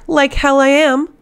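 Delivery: a defiant man refusing to be left behind; defiant refusal. A narrator's reading. Aedion refuses to stay behind.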